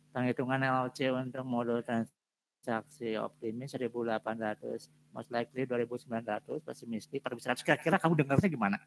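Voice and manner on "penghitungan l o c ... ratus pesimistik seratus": lazy monotone reading. Shown as a demonstration. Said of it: "presentasi itu harus ada intonasi".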